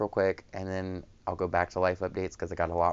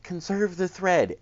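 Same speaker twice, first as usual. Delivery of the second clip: high mock mantra; 11 semitones higher than usual. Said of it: "at that point in my life where it's like".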